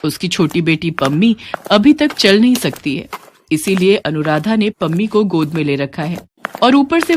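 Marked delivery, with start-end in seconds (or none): none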